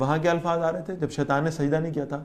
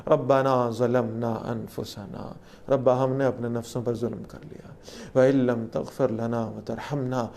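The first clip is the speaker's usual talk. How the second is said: humble prayer; 5 semitones lower, 3 dB quieter than usual. Acted. Adam and Eve begging God's forgiveness, humble low prayer. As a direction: pray it with bowed humility. Recites and translates repentance prayer.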